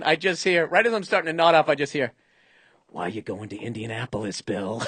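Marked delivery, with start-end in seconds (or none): 2.9-4.8 low, hushed mock-interrogation voice; imitated interrogator. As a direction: play the seatmate's question deep and soft, out of the silence. Imitates seatmate's sudden question in silence.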